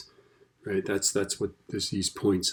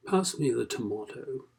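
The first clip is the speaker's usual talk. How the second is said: mock British accent; 4 semitones higher, 4 dB quieter than usual. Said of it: "I wish I was British".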